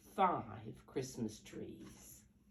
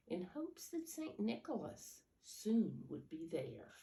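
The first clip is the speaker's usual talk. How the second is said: softer, unhurried reading of the poem; 4 dB quieter than usual. Acted. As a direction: read it like a bedtime poem. Reads the famous poem aloud.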